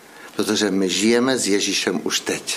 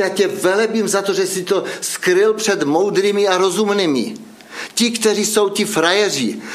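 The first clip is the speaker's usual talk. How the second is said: higher and raised, preaching at full force; fervent preaching; 8 semitones higher than usual. Acted. Preacher rebuking the know-it-alls before the congregation, fervent and raised. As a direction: drive the point home from the pulpit.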